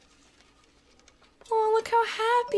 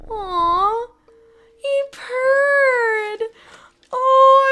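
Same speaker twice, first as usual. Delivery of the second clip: tearful, high and loud; choked up over a purring cat; 8 semitones higher, 10 dB louder, much slower than usual. Real, not acted.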